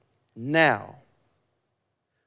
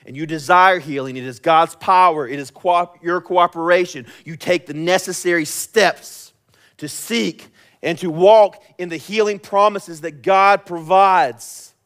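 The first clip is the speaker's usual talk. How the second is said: raised, urgent sermon voice; forceful preaching; 6 semitones higher, 8 dB louder than usual. Acted. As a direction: drive the exhortation home, loud and high.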